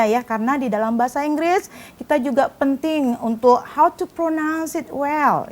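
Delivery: ordinary talk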